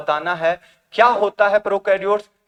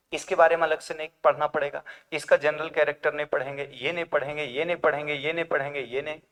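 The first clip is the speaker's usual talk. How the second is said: quick, softer, lower run of repeats; rattled-off list; 4 semitones lower, 7 dB quieter, faster than usual. Acted. Teacher listing what needn't be studied separately, rattled off quietly. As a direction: reel it off fast and low, like a tedious list.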